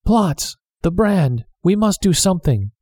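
put-on a young man blurting in alarm; mild frantic outburst; breathless panic. A narrator's reading. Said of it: "the young man gasped".

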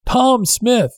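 a second shout across the water; shouted again. Acted. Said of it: "the man shouted".

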